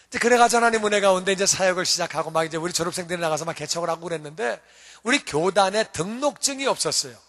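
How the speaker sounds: normal talking voice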